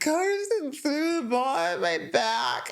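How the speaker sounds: put-on high, slow whiny little girl; imitating crying daughter. Little girl complaining to mom, whiny. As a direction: drag out the hurt complaint like a small child.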